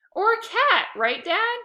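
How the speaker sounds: very high, loud yell from afar; screaming. A narrator's reading. Lexi screaming from another room to Dad. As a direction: shout it across the house. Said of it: "screamed Lexi from".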